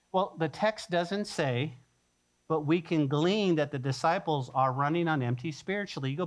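ordinary delivery

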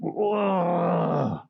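long, drawn-out groan of mock anger; boiling growl. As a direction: seethe in one long wordless growl. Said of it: "he is this boiling".

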